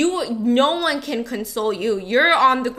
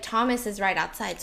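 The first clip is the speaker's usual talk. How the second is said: ordinary delivery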